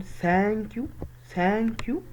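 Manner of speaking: "ثانك يو" high, rising on "يو" like a question; tongue-out th on "ثانك", lift "يو". Shown as a demonstration. Teacher asking who sticks the tongue out, "ثانك يو" rising. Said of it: "حد بيطلع لسانه يقول".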